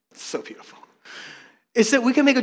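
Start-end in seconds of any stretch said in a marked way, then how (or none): 0.1-0.8 whispered teasing compliment; mock whisper. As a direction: breathe it out as a joking whisper. Teasing the congregation's noisy singing.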